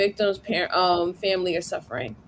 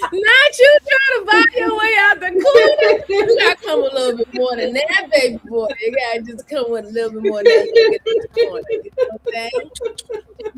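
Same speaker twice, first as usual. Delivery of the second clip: higher and louder, teasing through laughter; laughing tease; 10 semitones higher, 6 dB louder than usual. Real, not acted. Streamer teasing a viewer about his small tip, laughing hard. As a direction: laugh while you rib him for tipping so little.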